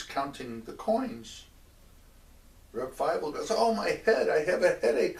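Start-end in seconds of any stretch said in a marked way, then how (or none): 3.5-5.2 high, loud mock wail of pain; hammed-up headache complaint. Reb Feivel to the landlord counting coins, hammed-up pained wail. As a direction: wail theatrically about an aching head. Feigned headache acted in story.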